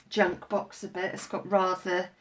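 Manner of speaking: usual speaking voice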